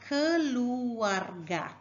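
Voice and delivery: "keluarga" drawn out slowly as ke-lu-ar-ga; syllable by syllable. Shown as a demonstration. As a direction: sound out each syllable of "keluarga". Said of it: "And in the word".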